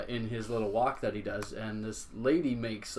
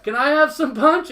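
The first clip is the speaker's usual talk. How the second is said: put-on very high, loud little kid voice; little boy whine; 11 dB louder than usual. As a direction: squeak it like a small boy left out. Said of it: "Iron Man or something goes".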